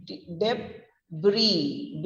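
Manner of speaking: debris very slowly, as deb, then ree; broken-down pronunciation. Shown as a demonstration. Teacher spelling out the sound, slow broken-down pronunciation. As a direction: draw debris out in two parts, no s. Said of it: "it's pronounced as".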